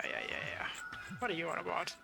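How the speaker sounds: ordinary talk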